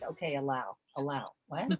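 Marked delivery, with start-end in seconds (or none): none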